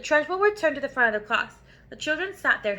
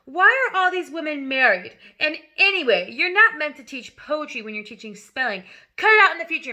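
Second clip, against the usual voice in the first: loud, higher scolding tirade; 4 semitones higher, 5 dB louder than usual. Acted. Headmistress scolding Miss Honey before the class, loud and angry. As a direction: berate her in front of the class.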